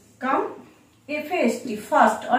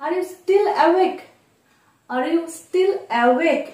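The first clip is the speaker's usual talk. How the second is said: slow; 5 semitones higher, much slower than usual. Shown as a demonstration. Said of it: "তো এটার জন্য আমরা এভাবে বলতে পারি".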